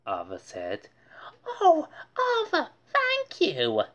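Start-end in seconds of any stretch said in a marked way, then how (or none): 1.5-3.8 high, moved thank-you; touched mother. Acted. Mother thanking Arthur for the tea set, moved. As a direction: gush with emotion. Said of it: "said Mom".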